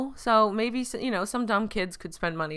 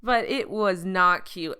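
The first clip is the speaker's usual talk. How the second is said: ordinary talk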